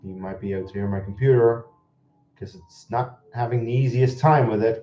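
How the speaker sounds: ordinary talk, nothing marked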